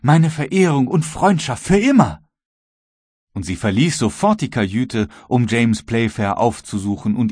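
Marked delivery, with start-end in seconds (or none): 0.0-2.2 put-on the young girl enthusiastically thanking Croxton; enthusiastic. A narrator's reading. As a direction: gush with heartfelt enthusiasm. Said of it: "rief das junge Mädchen begeistert".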